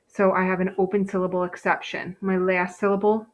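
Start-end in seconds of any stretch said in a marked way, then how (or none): none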